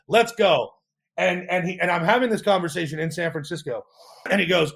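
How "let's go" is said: high, loud, celebratory retelling; elated acceptance. Retold or imitated. Founder recalling his first freight deal, elated. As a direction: leap on the offer excitedly.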